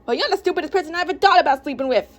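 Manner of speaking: high-pitched quarrelling comeback; heated, high-voiced bickering; indignant retort. Xavier retorts in the argument.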